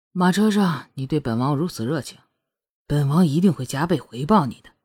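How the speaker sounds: low, unhurried male-lead voice; seductive teasing prince. A narrator's reading. Prince continues wooing Shuangyue in bed, seductive and low.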